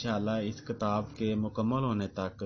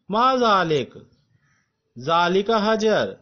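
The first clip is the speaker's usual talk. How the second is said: slow, raised, emphatic teacher read-aloud; classroom drill reading; 10 semitones higher, 11 dB louder, slower than usual. Acted. Teacher reading the lesson sentences aloud to students, slow and emphatic. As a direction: spell out each sentence for students to repeat.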